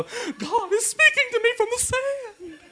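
mock awestruck sinner. Pastor mimicking a sinner finding the sand message, high mock awe. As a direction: squeal it in awe.

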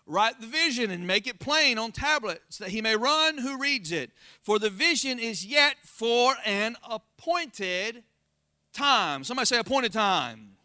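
usual speaking voice

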